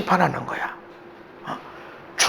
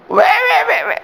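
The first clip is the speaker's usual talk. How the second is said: mock whiny why; 3 dB louder, slower than usual. Mocking demanding why.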